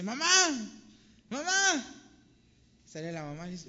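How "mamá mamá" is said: put-on high, loud panicked boy's cry for mom; a terrified little boy bursting in the door; frantic child calling. Scared boy bursts home shouting for his mother, frantic high cry. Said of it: "despavorido le dice".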